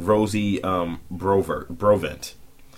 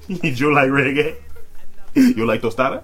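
mock Jamaican accent; 4 semitones higher, 3 dB louder than usual. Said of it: "He's trying to Jamaica-tize me".

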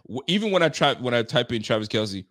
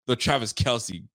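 normal talking voice

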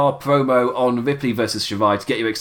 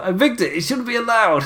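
mock whiny outrage; 10 semitones higher than usual. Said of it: "I was like".

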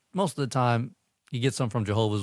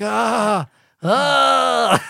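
high, louder yelps of pain; mock pain scream; 12 semitones higher, 6 dB louder than usual. Imitating the BMX rider screaming in pain after the crash. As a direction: yelp like a rider breaking his ribs. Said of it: "he's screaming, like, in pain".

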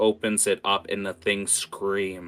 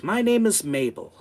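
prim introduction; 6 semitones higher, 3 dB louder than usual. Acted. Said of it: "And she goes".

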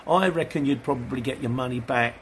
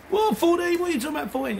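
mock outrage; 14 semitones higher than usual. Mock outraged market customer.

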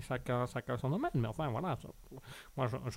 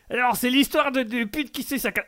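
higher uncle voice telling a joke; Tonton Jackie; 7 semitones higher than usual. Acted. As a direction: launch the joke like the corny uncle. Uncle starts joke, interrupted. (tonton qui raconte sa blague, voix haute)

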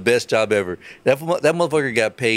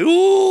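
high, loud crowd "ooh"; drawn-out mock crowd reaction; audience "ooh"; 6 dB louder than usual. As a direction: hoot like a scandalized studio audience.

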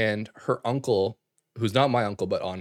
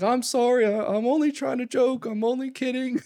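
much higher, pleading mock apology; frantic apology. Uncle soothing the sobbing niece, high pleading apology. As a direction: plead it high and fast, backpedalling.

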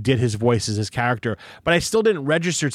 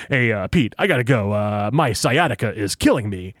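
low promo-announcer voice for small talk; voiceover guy; 4 semitones lower than usual. Retold or imitated. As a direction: imitate a promo man whose everyday voice is deep. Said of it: "how does he replicate that voice".

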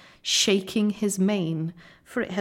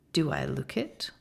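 gentle lion; 6 semitones lower than usual. A narrator's reading. Aslan's gentle reply.

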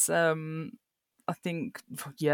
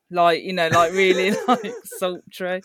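normal talking voice

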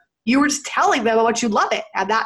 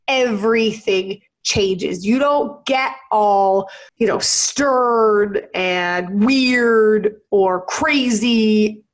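normal talking voice

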